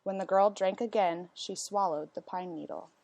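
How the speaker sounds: plain reading voice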